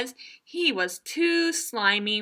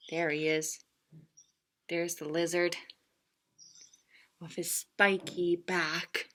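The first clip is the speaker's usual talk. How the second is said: hushed; 6 semitones lower, 8 dB quieter than usual. A narrator's reading. Lizard introduced hushed.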